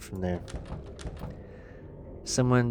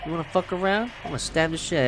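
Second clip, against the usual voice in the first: taunting; 6 semitones higher, faster than usual. Real, not acted. Fighting the butterfly, taunting it.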